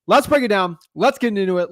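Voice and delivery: loud, pumped-up hype; hyped up. Real, not acted. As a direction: get the crowd jacked up. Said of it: "We gotta get you guys jacked up".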